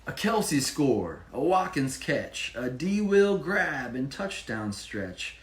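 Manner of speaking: sing-song picture-book narration; rhyming story reading. A narrator's reading. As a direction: read the rhymes to a child with energy. Reads rhyming story aloud.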